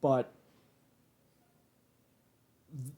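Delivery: calmed down. Real, not acted. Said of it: "calm down and relax".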